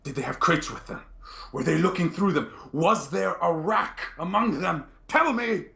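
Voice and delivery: high, frantic questioning that keeps climbing; agitated, escalating questions; urgent interrogation. Acted. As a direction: demand answers, pitch rising to a desperate peak. Inquisitor grabs witness, interrogates urgently.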